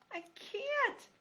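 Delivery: a frightened mouse cries out; mouse crying out. Acted. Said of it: "Poppy cried".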